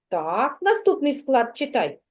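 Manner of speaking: ordinary delivery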